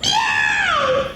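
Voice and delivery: very high loud yowl; shrieking meow; cat yowl. Acted. Angry cat.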